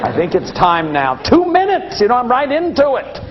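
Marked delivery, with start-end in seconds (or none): 0.1-1.2 strict grown-up. Retold or imitated. Elders checking the watch call an end to fun, low mocking voice. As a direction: play the killjoy checking his watch, dry and low. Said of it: "they would look at their watch and say".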